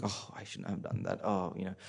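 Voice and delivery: soft, sunken muttering to oneself; muttered regret. Retold or imitated. The regretful mind mumbling to itself about the past, muttered softly. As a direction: mutter it like regret you can't shake. Imitating the mind's regretful thought.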